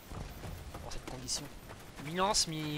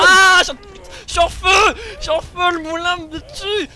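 high, very loud panicked scream; 12 semitones higher, 15 dB louder, slower than usual. Real, not acted. On fire in game, panics.

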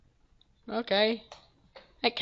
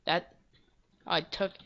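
ordinary talk

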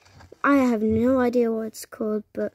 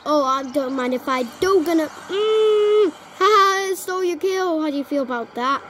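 normal talking voice

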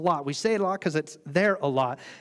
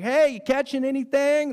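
high, louder casual call; Jesus calling from shore; 9 semitones higher, 6 dB louder than usual. Retold or imitated. Jesus calling to the fishermen from shore, high and casual. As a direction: call out across the water, high and breezy. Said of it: "and he's like".

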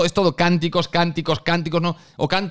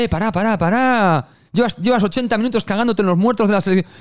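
friend pushing back; 5 semitones higher than usual. Retold or imitated. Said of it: "Y le dice".

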